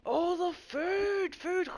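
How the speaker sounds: high, slow, awed food craving; greedy gasp. Retold or imitated. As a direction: gasp it like a starving anime glutton. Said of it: "I was just like".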